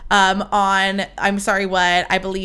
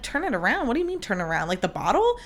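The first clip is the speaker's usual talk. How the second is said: softer, puzzled questioning; confused echo; 6 dB quieter, faster than usual. Retold or imitated. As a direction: echo TURN IT AROUND as a baffled question. Retelling her confused echo.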